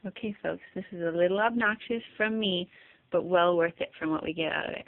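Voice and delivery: ordinary talk, nothing marked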